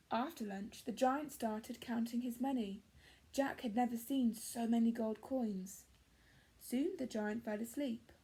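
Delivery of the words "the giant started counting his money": plain reading voice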